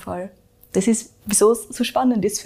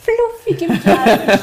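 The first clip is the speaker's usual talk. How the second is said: amused laughing echo; 9 semitones higher, 7 dB louder, slower than usual. Real, not acted. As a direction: repeat it through a laugh. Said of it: "ich liebe es".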